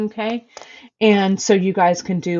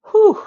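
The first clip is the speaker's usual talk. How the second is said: relieved whew; 13 semitones higher, 5 dB louder than usual. Real, not acted. Exhaling after setting cat down.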